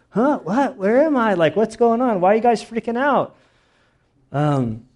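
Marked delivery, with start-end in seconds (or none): 0.1-3.4 much higher, dazed and confused; mock groggy waking up; groggy just-woken. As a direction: stumble out of sleep bewildered. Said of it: "Did he wake up like you wake up when you're exhausted".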